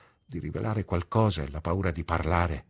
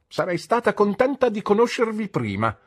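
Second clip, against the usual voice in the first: higher and louder, forthright; brisk aunt; 13 semitones higher, 6 dB louder than usual. A narrator's reading. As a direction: speak up firmly to the guest. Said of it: "disse mia zia". (la zia, decisa e a voce alta)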